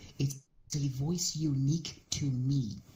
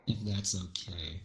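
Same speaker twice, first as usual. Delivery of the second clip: slow theatrical villain menace; 3 semitones lower than usual. Pretend. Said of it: "I'm just going to use this voice from now on".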